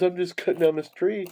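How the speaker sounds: lightly voiced innocent excuse, pitched high; innocent young man. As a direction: imitate a naive youth explaining himself. Youth excuses himself to man.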